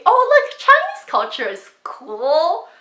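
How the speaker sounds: high loud gush, then drawn out; imitating someone overselling it; mock enthusiasm. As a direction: fake amazement, stretching out the end. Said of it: "in an attempt to say".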